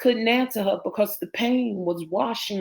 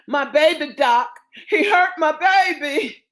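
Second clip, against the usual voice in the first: higher-pitched distraught cry; 7 semitones higher, 4 dB louder than usual. Acted. Father telling Doc his baby was hurt, distraught. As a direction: plead with rising anguish.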